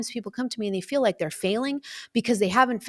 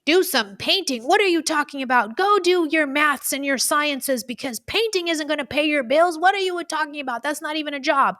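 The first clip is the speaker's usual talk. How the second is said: scolding parent; 9 semitones higher, 4 dB louder than usual. Retold or imitated.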